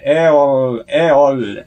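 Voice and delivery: L with the tongue pushed far forward; L said clearly, tongue on the teeth; L sound demo. Shown as a demonstration. Teacher contrasting the right L with the Korean one. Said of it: "L 그러면 안 돼".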